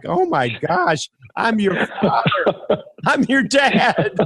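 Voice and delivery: high, slow and laughing through the words; laughing disbelief. Retold or imitated. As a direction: laugh at your own shock, stretching each word. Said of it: "I'm like".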